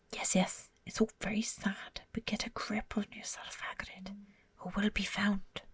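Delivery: hushed, urgent whisper. Acted. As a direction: whisper it so nobody hears. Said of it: "Professor McGonagall whispered".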